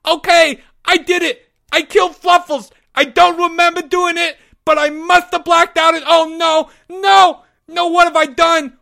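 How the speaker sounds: very high, loud, distraught wail; wildly screamed confession. Acted. Porge falsely confessing to Leo, wild screaming. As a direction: scream it out in horror. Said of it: "Porge screamed wildly".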